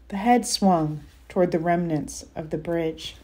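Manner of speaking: ordinary delivery, nothing marked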